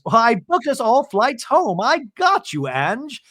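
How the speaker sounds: high-pitched, reassuring impression; hammed-up helpful organizer; Bronwyn. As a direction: pipe up eagerly in a high voice. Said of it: "And Bronwyn goes".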